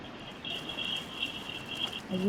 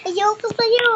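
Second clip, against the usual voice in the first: refusal; 11 semitones higher, 6 dB louder than usual. Acted. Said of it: "Sabi niya".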